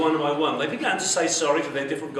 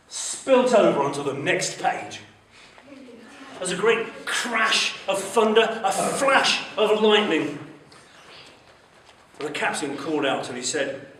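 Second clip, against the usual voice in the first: ordinary delivery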